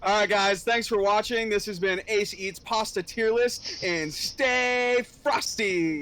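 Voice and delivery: drawn-out signature goodbye; outro catchphrase. Acted. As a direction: deliver the channel sign-off like a catchphrase. Catchphrase sign-off.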